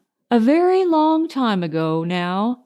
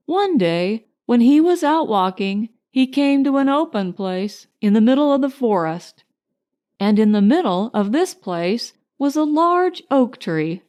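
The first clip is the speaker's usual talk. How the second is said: ordinary delivery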